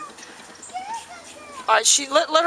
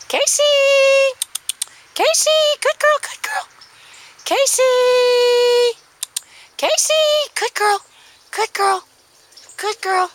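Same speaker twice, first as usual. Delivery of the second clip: calling the dog; 6 dB louder than usual. Real, not acted. Recall training calling the dog.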